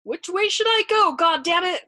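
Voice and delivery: high-pitched, loud, anguished demand; furious suffering shout. A narrator's reading. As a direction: shout furiously as over an intercom. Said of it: "shouted furiously over the intercom in a suffering, high-pitched voice".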